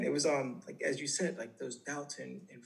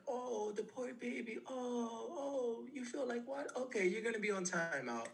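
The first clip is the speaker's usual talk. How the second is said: put-on little kid voice; 11 semitones higher than usual. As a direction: coo mockingly as if to a pouting toddler. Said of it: "became a little kid vo-, voice".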